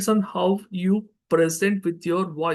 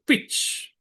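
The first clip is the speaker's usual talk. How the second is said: whisper; 3 dB quieter than usual. Shown as a demonstration. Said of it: "demo of pitch".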